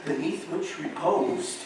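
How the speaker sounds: hushed, measured storytelling; dramatic recitation. Acted. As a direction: draw the audience in slowly.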